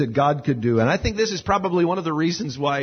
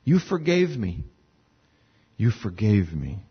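tender; 6 semitones lower than usual. Acted. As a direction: say it tenderly, almost in wonder. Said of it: "you can almost hear the tenderness in David's voice".

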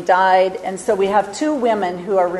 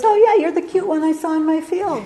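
high-pitched, playful realisation; comically perked-up, high voice; playful delighted recognition; 9 semitones higher than usual. Retold or imitated. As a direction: act out pleased recognition, pitch jumping up. Voicing Boaz recognising Ruth in retelling.

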